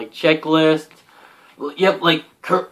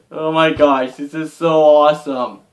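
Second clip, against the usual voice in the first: usual speaking voice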